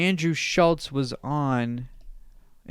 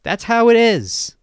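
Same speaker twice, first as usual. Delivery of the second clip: imitating, climbing higher and louder to the end; blunt mock statement; 9 semitones higher, 7 dB louder than usual. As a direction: say it straight up, building to a loud finish. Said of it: "he's just gonna be like".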